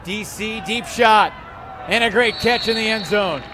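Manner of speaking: rising excited shout on the catch; excited play call. Real, not acted. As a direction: call the score with a burst. Goal call, big catch.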